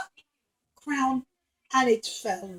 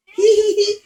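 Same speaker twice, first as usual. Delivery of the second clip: put-on high, loud childish giggle; princess giggling; 7 semitones higher, 8 dB louder than usual. Princess giggles at the juggling, high giggle. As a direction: giggle with delight. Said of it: "she started to giggle, giggle".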